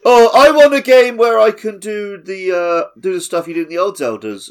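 high, loud sneering fan voice; mocking whiny fan. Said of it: "mocking nostalgic fans".

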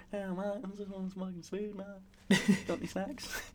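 high, very soft stoner shark; shark character. Acted. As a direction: play a hushed shark bumming snacks. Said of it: "The shark".

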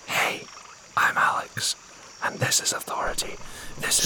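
breathy whispered podcast intro. Acted. Host at a silent retreat, whispering his intro. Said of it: "You don't hear me whispering?".

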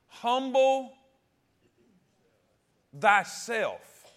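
"Humble" called out high and loud; call to humble thyself. Shown as a demonstration. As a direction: ring out "Humble" for the church to answer. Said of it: "Let me say it again".

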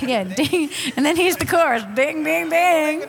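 high, loud, hammed-up dinging; mock chorus dings. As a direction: ring out the chorus hits like a bell, mocking. Mocking a predictable song chorus.

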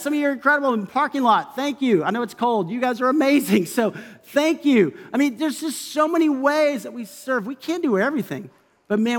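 ordinary talk

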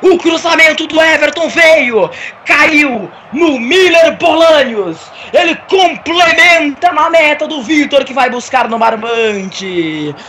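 slow, shouted stadium narration; title-winning goal call. Acted. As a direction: roar out the championship goal. Live goal call for Grêmio. (narração épica de título)

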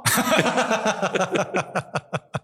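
long high-pitched laughter. Real, not acted. Joke teller laughs hard at his favorite joke, high ringing laugh. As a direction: let the laugh ring out.

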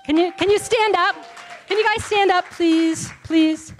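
loud, high plea to the room; calling out. Real, not acted. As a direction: call them up to stand, insistent. Calling her boys to stand.

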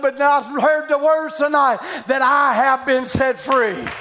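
loud revival preacher, pitch high and shouting; preaching at full force. Acted. Preacher shouting over a woman at the altar, full force. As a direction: preach it out to the whole hall.

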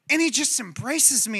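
normal talking voice

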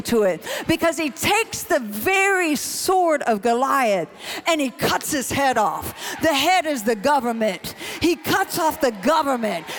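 full-voiced, emphatic sermon storytelling; impassioned preaching. Real, not acted. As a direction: preach the victory with rising fervour.

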